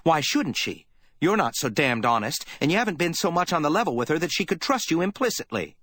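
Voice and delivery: put-on a worried girl lashing out in contempt; young secretary, furious. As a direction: tear into him for doing nothing. Effie voiced, accusing Spade.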